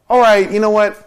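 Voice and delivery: loud, fed-up diner caving in; exasperated giving in. Acted. Diner caving to the waiter's pushing, loud and fed up. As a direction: throw your hands up and give in, loudly.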